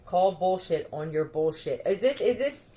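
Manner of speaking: ordinary talk, nothing marked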